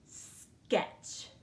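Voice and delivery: "Sketch" whispered, each sound crisp; whispered model word. Shown as a demonstration. Teacher whispering the spelling word for pupils to listen. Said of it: "Let's listen to the word".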